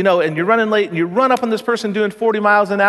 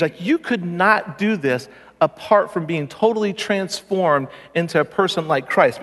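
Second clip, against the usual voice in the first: ordinary talk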